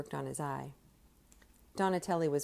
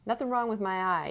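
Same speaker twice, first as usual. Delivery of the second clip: higher, loud defiant shout; yelled; 6 semitones higher, 5 dB louder than usual. A narrator's reading. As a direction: fire it back at him. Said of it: "yelled Willie".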